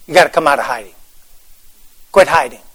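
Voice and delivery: low, quiet, earnest call; soft appeal. Real, not acted. Pastor to the listeners, a low quiet appeal. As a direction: drop it down and plead. Preacher repeats the call softly.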